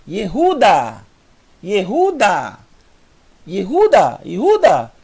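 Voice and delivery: "Yehuda" with the Ye swallowed fast; quick Ye sound. Shown as a demonstration. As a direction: model "Yehuda", barely sounding the e. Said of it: "Veja que é tão rápido o I". (professora lendo "Yehuda" com o Ye quase engolido)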